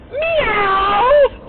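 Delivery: high, loud sustained meow; really loud meow. Retold or imitated. Player meowing to her team, really loud meow. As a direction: yell a long piercing cat call. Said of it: "Like, really loud".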